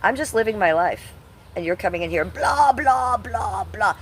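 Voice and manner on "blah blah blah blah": higher, louder mocking gibberish; mocking high blah blah at the troll. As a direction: mimic the chatter with a sneering blah. Mocking chatter who comes in.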